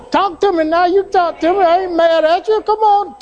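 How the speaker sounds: preacher's call to the congregation. Real, not acted. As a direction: coax the church to answer back.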